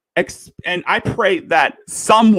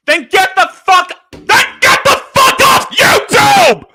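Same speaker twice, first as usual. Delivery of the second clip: very high, loud furious scream; 9 dB louder than usual. Real, not acted. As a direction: scream it at the top of your lungs. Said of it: "I am sorry for yelling".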